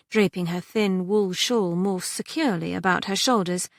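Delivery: ordinary delivery, nothing marked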